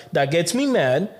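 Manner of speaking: ordinary talk, nothing marked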